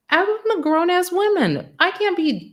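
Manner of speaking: high, loud and defiant, with real attitude; sassy declaration. Real, not acted. Telling the crowd she won't take her father's name, sassy and defiant. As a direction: own it, sassy and proud.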